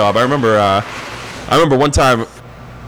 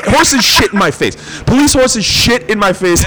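ordinary talk

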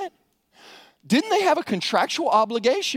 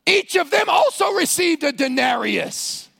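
higher, louder, slow dramatic punch; shouted reveal; 9 semitones higher, 3 dB louder than usual. Acted. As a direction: hit the twist hard. Preacher reveals the twist.

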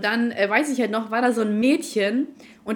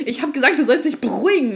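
louder retort; 4 semitones higher, 7 dB louder than usual. Retold or imitated. Her own retort, retold.

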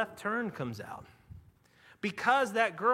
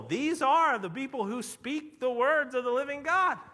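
higher-pitched mock herald proclamation; herald's proclamation; 6 semitones higher, 3 dB louder than usual. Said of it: "proclaiming to all the people".

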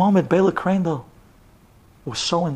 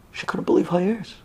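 ordinary delivery

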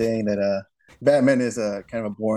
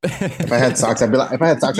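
usual speaking voice

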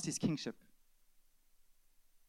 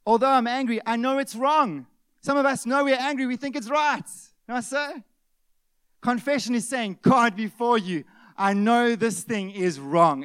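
loud, high-pitched pulpit preaching; preaching at full force; 7 semitones higher, 10 dB louder than usual. Acted. Preacher warning the church about missing the kingdom, loud and urgent. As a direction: drive the warning home to the congregation.

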